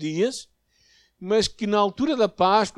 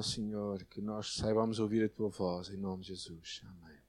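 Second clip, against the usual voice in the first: low, soft prayer trailing into near silence; 7 semitones lower, 6 dB quieter than usual. Real, not acted. Preacher praying to the Lord before the message, quiet and low. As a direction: pray quietly, addressing God intimately.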